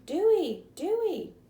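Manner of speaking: high, loud panicked screams; screaming. A narrator's reading. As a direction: scream the name in terror. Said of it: "Chet ran screaming".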